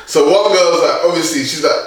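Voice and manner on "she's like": ordinary talk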